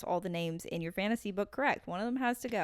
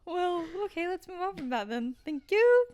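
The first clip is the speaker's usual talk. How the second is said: mock offended; 8 semitones higher, 3 dB louder than usual.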